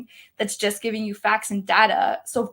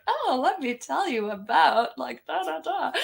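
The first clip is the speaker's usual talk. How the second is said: laughing mock pitch; 4 semitones higher than usual. Her retold sales pitch.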